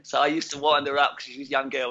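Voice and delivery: usual speaking voice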